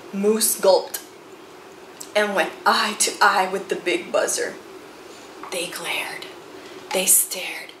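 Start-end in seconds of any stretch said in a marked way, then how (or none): 5.5-7.7 tense whisper. A narrator's reading. Tense standoff.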